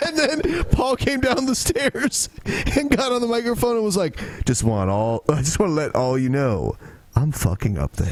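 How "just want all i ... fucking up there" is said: low, matter-of-fact imitation of a friend; deadpan announcement. Imitating a friend stepping up to the mic, deadpan low.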